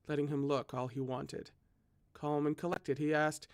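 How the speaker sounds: usual speaking voice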